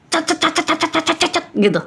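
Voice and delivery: high, soft rat-a-tat of syllables; playful imitation of babbling speech; mock rapid chatter. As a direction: rattle it off like someone talking too fast. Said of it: "Cepet-cepet bicaranya".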